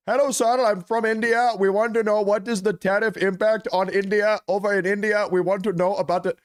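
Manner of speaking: high-pitched mock Indian accent, eager reporter. Streamer mocking a foreign reporter asking Trump, Indian accent. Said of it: "why do all these reporters have foreign accents".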